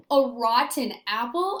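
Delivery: ordinary talk, nothing marked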